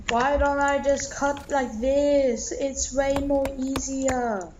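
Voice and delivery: mock a know-it-all viewer, drawn out; whiny viewer impression. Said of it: "sorry for my impression on you".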